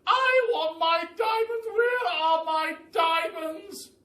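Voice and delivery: very high, loud, drawn-out shrieking demand; hysterical Duchess. A narrator's reading. Duchess voiced in the story.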